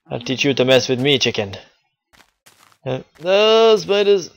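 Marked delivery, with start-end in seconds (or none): none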